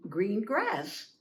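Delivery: plain reading voice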